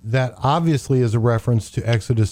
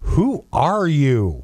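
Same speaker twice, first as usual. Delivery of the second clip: slow, drawn-out disbelief; taken aback; much slower than usual. Acted. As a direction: stretch it out, as if stunned by the claim. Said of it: "sure they were just taken aback".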